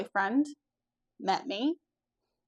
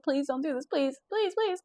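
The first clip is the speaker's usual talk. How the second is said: retold begging; 6 semitones higher, 3 dB quieter than usual. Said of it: "And I started crying".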